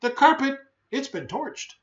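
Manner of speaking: high, indignant, fading at the end; cross dog voice. Acted. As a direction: complain about the burnt carpet. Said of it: "he said".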